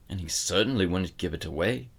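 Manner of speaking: ordinary delivery, nothing marked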